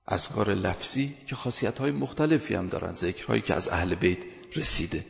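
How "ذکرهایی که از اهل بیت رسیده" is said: ordinary talk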